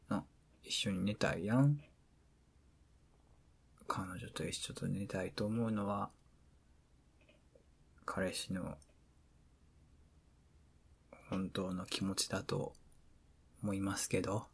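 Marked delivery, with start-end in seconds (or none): 3.9-14.5 soft sincere. Real, not acted.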